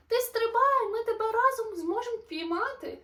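high, loud reassuring yell; urgent shout. Acted. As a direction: shout up that they'll catch him. Animals shout below.

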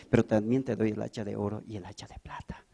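soft unvoiced whisper. Angel whispering the reward to the honest man. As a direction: whisper the gift secretly.

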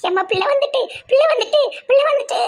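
joyful shouting; excited shout. Acted. Child found, shouting with relief.

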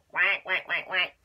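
loud quacking; duck quacks. Acted. Reading the duck's quacks to children, loud quacks.

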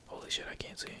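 normal talking voice

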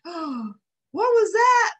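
high, slow startled gasp; gasping Lady. Acted. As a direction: gasp it out in a high, loud alarm. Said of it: "Lady gasped".

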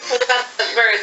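ordinary delivery

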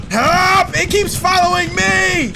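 high, loud, drawn-out cry for help; panicked yelling. Real, not acted. Player followed by a creature calls teammates, panicked. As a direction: yell as if chased.

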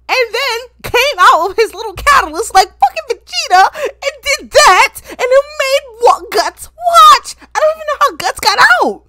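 high, loud, incredulous outburst; outraged rant. Real, not acted. Anime fan ranting about what Guts had to watch, outraged. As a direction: voice climbing loud in disbelief.

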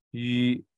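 U said as the rounded Mandarin ü, like yu; ü vowel demonstration. Shown as a demonstration. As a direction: sustain U as a Mandarin ü, lips rounded. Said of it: "它的念法是念".

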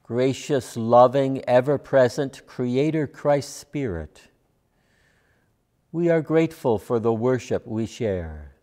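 normal talking voice

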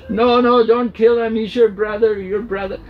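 high, loud begging that sinks to a plea; wife begs her angry husband to spare his brother; frantic pleading. Acted. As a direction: hold him back and beg him to stop. Parvati restrains her furious husband.

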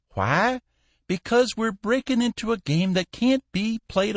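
a hint of a cranky old shipowner grumbling; fussy old boss. A narrator's reading.

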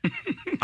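a quick high laugh at her own story. Real, not acted. Host laughing at her own story for the listener, high laugh. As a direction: burst out laughing at the punchline.

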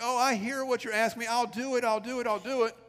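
placating husband. Retold or imitated. Husband promising his wife he'll do the dishes, hastily placating. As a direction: imitate a husband brushing off reminders with eager promises.

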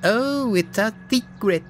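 very high, mocking singsong taunt; teasing falsetto sputter; giggling sputter. A narrator's reading. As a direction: squeal it like a tittering bully sidekick. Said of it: "he sputtered".

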